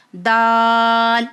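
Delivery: دال held very long and clear; slow letter name. Shown as a demonstration. Teacher pronounces the letter دال for the viewers, drawn out. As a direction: stretch out دال slowly. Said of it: "دال کا sound کیسے ادا ہوگا".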